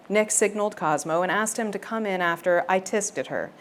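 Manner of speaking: usual speaking voice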